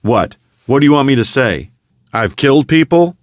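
bitter, rising challenge to his brother; bitter outburst. A narrator's reading. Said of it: "Paul let out a bitter laugh".

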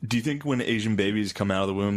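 normal talking voice

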